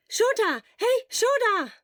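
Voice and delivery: loud, high call to wake a sleeping friend. Acted. Friend shouting to wake a sleeping Shota, high and loud. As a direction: shout his name to rouse him.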